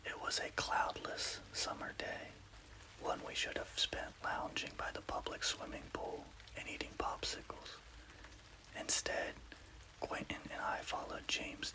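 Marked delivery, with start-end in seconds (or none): none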